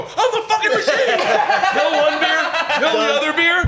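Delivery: high, laughing boast; victory yell. Retold or imitated. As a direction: shout it like a champion.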